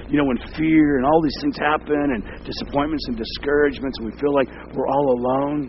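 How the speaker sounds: loud, pressing sermon voice; loud fervent preaching. Acted. Pastor loudly assuring the congregation they are not alone. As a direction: drive the comfort home loudly.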